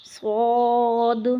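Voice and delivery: صاد with a full mouth, long; heavy sad. Shown as a demonstration. Teacher models a full-mouthed صاد. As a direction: round the lips for a thick صاد. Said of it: "منہ بھر کر،".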